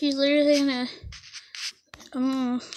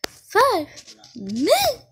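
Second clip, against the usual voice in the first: higher, louder disbelieving cry; shocked repeat; 7 semitones higher, 6 dB louder, much slower than usual. Real, not acted. Player shocked at how little time is left, exclaiming. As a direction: echo it in disbelief.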